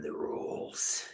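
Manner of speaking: whispered under the breath. Real, not acted. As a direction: breathe the name softly. Reading chat username aside.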